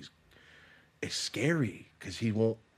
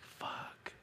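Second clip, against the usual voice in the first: dropped to a whisper; whispered curse; 14 dB quieter than usual. Real, not acted. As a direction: mutter it in dismay. Sees dad is using drugs.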